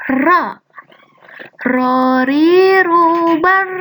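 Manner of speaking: slow chant, rolled r; teacher drilling the ra letter. Shown as a demonstration. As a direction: roll each ra slowly. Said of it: "Itu huruf ra".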